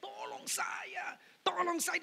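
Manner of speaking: acted plea, pitched high; retold cry for help. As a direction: imitate a woman calling out for help. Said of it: "Ibu ini berteriak-berteriak".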